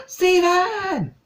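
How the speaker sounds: a sudden startled yelp of joy; shocked cry. Retold or imitated. Pearl suddenly spotting Steven, a high loud shriek of his name. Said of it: "and then she's like".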